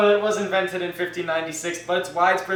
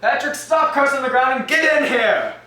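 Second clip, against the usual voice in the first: calling out; 6 semitones higher, 4 dB louder than usual. Acted. Irish mother calls husband inside.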